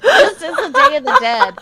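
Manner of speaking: high, laughing to the point of tears; laughing through tears. Real, not acted. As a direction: wail it out while cracking up. Hysterical laughing mock panic.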